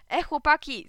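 high, loud call. A narrator's reading. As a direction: holler to the other boys. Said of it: "zawołał Kleofas".

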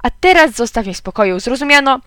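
mildly shouted irritation; irritated shout. A narrator's reading. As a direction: demand to be left alone. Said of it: "krzyknął".

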